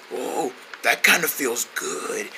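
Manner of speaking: low, soft, slow relief; soothed. Acted. King getting his throat rubbed, soothed and soft. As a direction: melt into it quietly.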